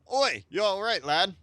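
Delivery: loud, high hail to a waking lad; voiced sailor. Sailor shouting to the waking lad, a rough hail. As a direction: call out like a gruff old sailor. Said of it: "I'm gonna try to voice over everybody".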